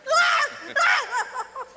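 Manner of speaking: high shrieking laugh; shrieking cackle. Acted. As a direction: burst into a wild screeching laugh. Clown laughing wildly.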